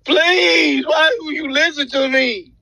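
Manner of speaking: usual speaking voice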